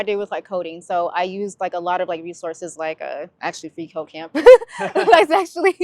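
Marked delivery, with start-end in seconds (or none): 4.3-5.8 bursts into a real laugh, high and loud; giggling as she names the resource. Real, not acted. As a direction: laugh through the words, slowing down. Laughs mid-sentence about resource.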